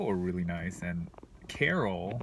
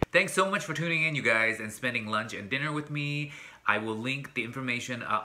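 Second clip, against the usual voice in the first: ordinary delivery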